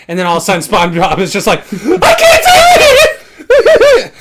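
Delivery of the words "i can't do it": high, slow, wailing mock sob; fake-bawl it like a little girl; crying like a little girl. Friend re-enacts SpongeBob breaking down, mock sobbing. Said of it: "Crying like a little girl".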